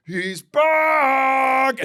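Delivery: high, drawn-out shouted He's back; meme announcer shout. Retold or imitated. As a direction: belt it like a hype meme announcer. Mimicking meme video announcer.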